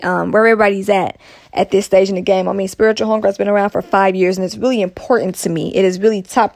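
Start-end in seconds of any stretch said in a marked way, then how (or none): none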